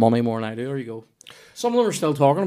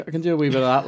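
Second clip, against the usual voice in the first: ordinary talk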